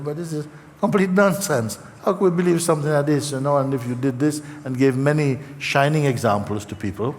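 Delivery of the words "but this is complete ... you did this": higher, fast, brushing the idea aside; dismissive retort. Retold or imitated. Zen master waving off a foolish belief to the people, high fast scoff. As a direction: scoff it away as nonsense, quickly. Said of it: "comes Bankai and just said".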